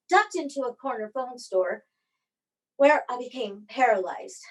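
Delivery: normal talking voice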